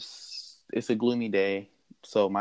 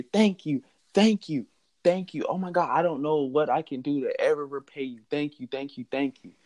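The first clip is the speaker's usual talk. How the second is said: high, gushing thanks that gradually settles; acted elated, repeated thank-yous; ecstatic gratitude; 6 semitones higher than usual. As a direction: gush with overjoyed relief, pitched well up. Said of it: "Michael is just ecstatic".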